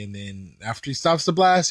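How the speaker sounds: ordinary talk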